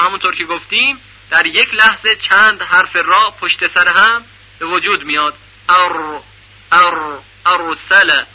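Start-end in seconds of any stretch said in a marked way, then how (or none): 5.7-8.2 ار and ارسل with the r trilled hard and repeated; wrong demo, the r in ارسل buzzing with many taps; overrolled r. Shown as a demonstration. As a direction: exaggerate the trill on every r of ارسل. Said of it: "این نوع تلفظ غلط هست".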